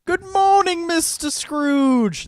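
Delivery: high, loud, very slow greeting; stage line. Retold or imitated. Recalling their only line in the play, high and drawn out. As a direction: sing it out like a child actor on stage. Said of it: "that was my line".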